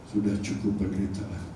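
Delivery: low, soft voice of God; a hushed divine voice. Retold or imitated. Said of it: "retold voice". (suara Tuhan yang rendah dan lembut)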